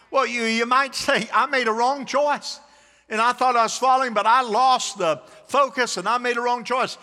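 loud, high-pitched sermon delivery; preaching at full force. Real, not acted.

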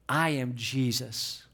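low, slow, solemn divine voice; the voice of Jesus. Acted. Jesus answering Paul on the road, in a low slow voice. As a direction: speak the name slowly and deep. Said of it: "look at the response".

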